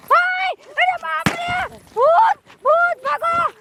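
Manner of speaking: high, slow frightened cry of ghost; scared shout. Acted. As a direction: yell in fright and run. Villager sees a ghost.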